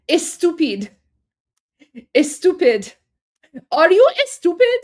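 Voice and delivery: mock Persian accent, building to a high loud are you estupid. Imitating a Persian accent to viewers, building to a shout. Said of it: "Armin can probably do the accent better".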